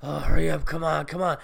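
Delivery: low-pitched impatient goblin at the door; spooky goblin. Retold or imitated. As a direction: imitate a creepy goblin urging someone to hurry. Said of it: "this shit goblin is fucking spooking me out".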